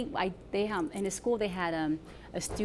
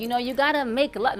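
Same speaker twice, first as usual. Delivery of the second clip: New York accent; 4 semitones higher, 6 dB louder than usual. Shown as a demonstration. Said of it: "We're gonna say it like in a New York accent".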